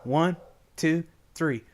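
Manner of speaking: ordinary talk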